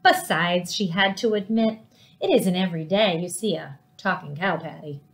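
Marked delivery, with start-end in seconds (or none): none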